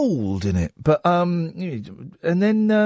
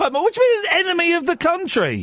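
high, affronted question; incredulous, offended pitch; indignant disbelief; 8 semitones higher than usual. Real, not acted. Host affronted by insult.

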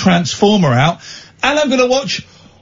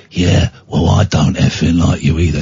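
low tough-guy voice out the side of the mouth; Jason Statham impression; 11 semitones lower than usual. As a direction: mutter it like a hard man. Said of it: "Statham talks out the side of his mouth like that".

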